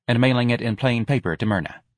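ordinary delivery

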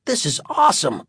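high, swooping mimicry of a teenager; imitating Terry. A narrator's reading. Dad ignoring his wife's pleas, imitating his son. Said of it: "imitating Terry".

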